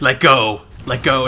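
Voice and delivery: abrupt, loud imitated command; stern. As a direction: switch straight into a forceful order. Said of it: "immediately getting up, like".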